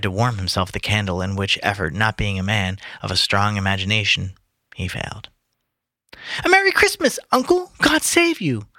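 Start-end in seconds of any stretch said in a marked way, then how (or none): 6.4-8.7 high, cheerful cry of the nephew; cheery nephew. Acted. Nephew greeting Scrooge, high and cheery. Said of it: "cried a cheery voice".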